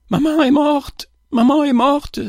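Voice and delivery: put-on very high-pitched, wailing child's voice; sobbing child. A narrator's reading. Eldest child beside collapsed mother, high sobbing cry. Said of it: "sanglotait l'aîné".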